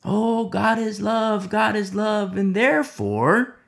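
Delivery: hammed-up lax pastor excusing sin; mocking pastor. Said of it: "I wonder what the pastors were like".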